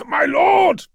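high, loud cry to his lord; desperate cry. A narrator's reading. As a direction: cry out across a raging storm. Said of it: "he cried to Sanguinius".

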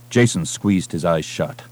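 plain reading voice

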